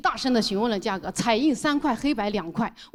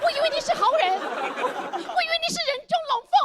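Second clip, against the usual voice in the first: much higher, wounded and naive, exaggerated foreigner voice; foreigner Thomas. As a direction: play the betrayed innocent, pleading high. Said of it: "对着打印店的老板".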